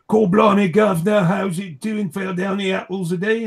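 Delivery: hammed-up Cockney accent read from chat; terrible Cockney accent. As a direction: ham up a terrible London Cockney. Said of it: "it's a terrible Cockney accent".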